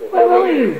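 high-pitched worried call; alarmed cry. A narrator's reading. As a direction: call anxiously after a child. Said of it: "she cried".

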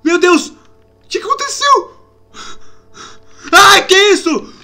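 high, loud cries of alarm; frightened questions at a sudden attacker; shocked fright. Real, not acted. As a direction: voice leaping up in bewildered panic.

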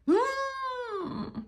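high, soft whimper on hmm; a small hurt whimper; whimpering hum. Acted. Friend hurt by the news, soft high whimper. Friend upset hearing about fun.

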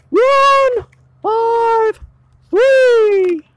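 high, stretched ghostly wail. Acted. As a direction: moan the numbers like a spooky ghost. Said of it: "I was trying to do a little ghostly voice".

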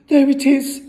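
high, loud outburst; alarmed cry. A narrator's reading. Brother hears the voice again, crying out. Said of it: "cried the brother".

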